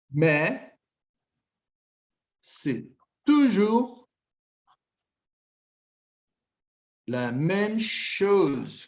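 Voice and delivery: "c'est toujours la même chose" stretched very slowly; drawn-out teacher reading; slow dictation. Shown as a demonstration. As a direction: spell out each word slowly for students. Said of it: "reads dialogue line slowly".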